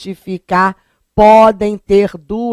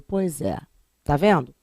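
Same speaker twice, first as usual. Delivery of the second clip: ordinary talk, nothing marked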